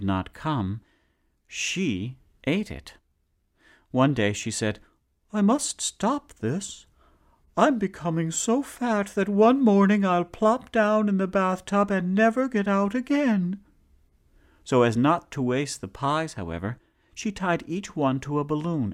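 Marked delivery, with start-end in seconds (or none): 5.3-13.6 much higher, rueful voice; fretting wife. Acted. Wife deciding to stop eating pies, high and rueful. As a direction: scold yourself with a laugh. Woman talking to herself.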